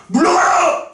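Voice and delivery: high rallying cry, drawn out; mock protest shout. Teacher joking about what "demo" means, mock protest shout. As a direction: call it out like a demonstrator.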